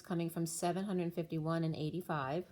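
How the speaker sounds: ordinary talk, nothing marked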